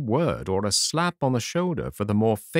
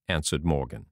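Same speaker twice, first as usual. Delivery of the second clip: usual speaking voice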